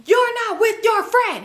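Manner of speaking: high-pitched mock scolding, no real shout; retold yelling. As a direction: scold as if acting out a row. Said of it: "He's yelling at her".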